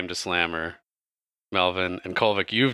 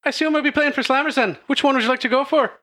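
high, quick showy female voice; bright lilting gambler voice, raised high; Kalora speaking; 4 dB louder, faster than usual. Acted. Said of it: "and she says".

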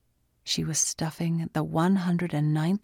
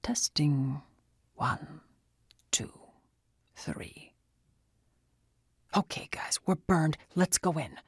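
whispering; 3 dB quieter than usual. A narrator's reading. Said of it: "He whispered into her ear".

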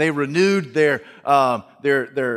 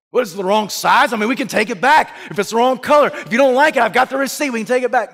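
louder, higher, hurried pleading; anxious son; 8 semitones higher, 5 dB louder, faster than usual. Acted. Son worried his mother dislikes the dress, anxious. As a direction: rush to offer the receipt.

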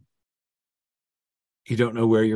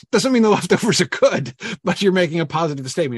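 amused aside; 7 semitones higher, 5 dB louder than usual. Real, not acted. Amused aside about fridge leftovers.

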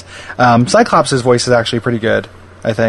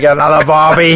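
exaggerated Australian accent; hammed-up mock Australian; mock Wolverine. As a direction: throw out slang in a bad Australian accent. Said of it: "uses Australian, uh, uh, like, slang".

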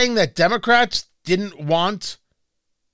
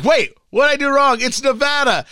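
retold bewildered protest; 8 semitones higher than usual. Retold own confusion.

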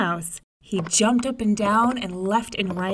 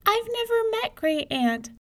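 put-on much higher small child voice; little girl voice. May May tells her mother, in a high child voice. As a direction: play the young girl, high and innocent.

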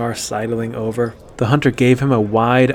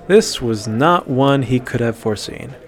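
usual speaking voice

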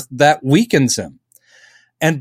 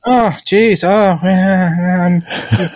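mock flirty Lois; 3 semitones higher than usual. Voicing Lois flirting with Superman.

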